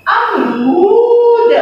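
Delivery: अमरूद drawn out slowly; slow syllables. Shown as a demonstration. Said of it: "teacher demo".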